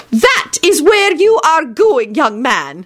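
slow, high headmistress decree, dropping at the end; stern intoning. A narrator's reading. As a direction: lay down the law slowly. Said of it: "she intoned sternly".

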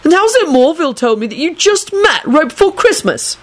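high-pitched bewildered question; puzzled, irritated. A narrator's reading. Neville challenging their story, puzzled and irritated. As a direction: question them in exasperation. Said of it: "puzzled and irritated voice".

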